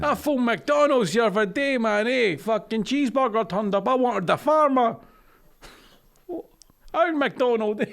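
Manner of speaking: Scottish moaning bloke, lightly done; Scottish accent. Pretend. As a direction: keep the Scottish rant going about McDonald's. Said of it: "not even gonna laugh at you".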